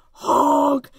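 big loud truck horn; yelled honk. A narrator's reading. Dump truck barges onto the road, loud honk. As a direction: blast it out long and loud. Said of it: "yelled a dump truck".

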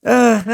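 loud exasperated ah; frustrated groan. Real, not acted. Exasperated sigh-shout.

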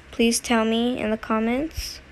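ordinary talk, nothing marked

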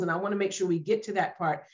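ordinary delivery

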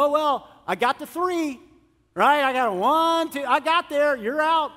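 loud, high mock umpire calling strikes; hammed-up umpire counting you out; mock strict umpire.

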